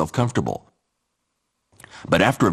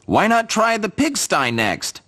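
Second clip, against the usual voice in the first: much higher chirpy bird; 12 semitones higher than usual. Acted. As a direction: tease like little birds. Birds suggest.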